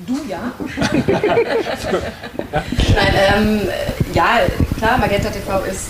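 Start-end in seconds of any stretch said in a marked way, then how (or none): 0.5-2.9 kurzes amüsiertes Lachen. Real, not acted. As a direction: lach kurz auf. Laughs at viewer's question. (herzhaftes Lachen)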